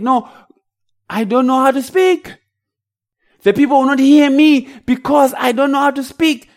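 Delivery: high, loud arguing protest; protesting Moses. Acted. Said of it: "Moses tried to argue with God".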